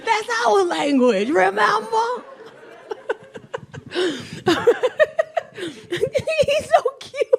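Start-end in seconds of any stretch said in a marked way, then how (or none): 5.6-7.3 very slow, high and fond; doting. Real, not acted. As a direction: coo it lovingly. Doting over grandson.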